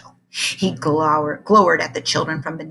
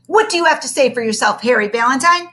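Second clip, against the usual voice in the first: stern teacher; 6 semitones higher, 6 dB louder than usual. Acted.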